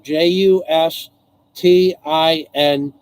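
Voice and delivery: each letter of J U S T I N said slowly and separately; J U S T I N, spelled one letter at a time. Shown as a demonstration. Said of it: "[name]".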